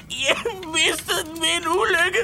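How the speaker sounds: tearful confession. Acted. New employee admits his painful loss to the boss, tearful. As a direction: confess the loss through tears.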